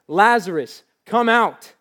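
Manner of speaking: loud, high call to the tomb; loud cry. Acted. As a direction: cry it out as Jesus at the grave. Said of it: "he cried out in a loud voice".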